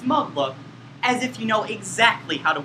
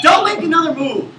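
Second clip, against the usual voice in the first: threatening shout; 5 semitones higher, 6 dB louder than usual. Acted. Villain threatens.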